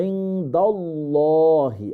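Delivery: "ইনদাল্লাহি" chanted slowly with heavy rounded "llaw"; full-mouth Allah. Shown as a demonstration. Teacher showing the joined word, full-mouthed slow "ইনদাল্লাহি". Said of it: "আল্লাহ একটা ফুল মাফ".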